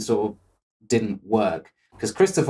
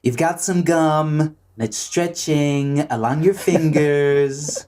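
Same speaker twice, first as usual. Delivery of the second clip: slow mock-presidential drawl; Donald Trump impression; 3 semitones higher, slower than usual. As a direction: drag it out like the politician being parodied. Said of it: "That's my Donald Trump impression".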